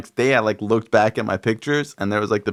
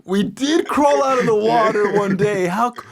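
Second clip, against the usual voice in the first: blown away by a silly revelation; mock stoner epiphany; 10 semitones higher than usual. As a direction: imitate tripping wonder, pitched way up. Acting out acid-trip epiphany.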